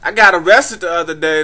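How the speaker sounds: usual speaking voice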